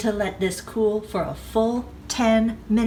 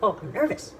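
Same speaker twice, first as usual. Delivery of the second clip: ordinary talk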